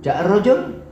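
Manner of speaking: "ja'ar rojul" rising high at the end; question intonation. Shown as a demonstration. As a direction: ask "ja'ar rojul" as a question, pitch climbing on rojul. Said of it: "Nah, istifhamiyah".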